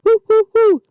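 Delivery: high, loud ape hoots; gorilla taunt. A narrator's reading. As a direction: hoot like a monkey to taunt. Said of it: "taunted the redhead".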